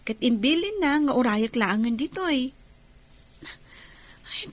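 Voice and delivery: normal talking voice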